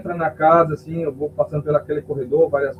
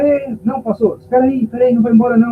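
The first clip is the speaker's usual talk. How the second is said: calling out; 7 semitones higher, 5 dB louder than usual. Retold or imitated.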